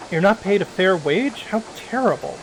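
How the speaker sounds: ordinary talk, nothing marked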